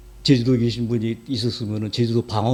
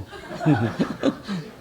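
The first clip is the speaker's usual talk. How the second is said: laugh; 4 semitones higher than usual. Real, not acted. Laughs after dialect imitation.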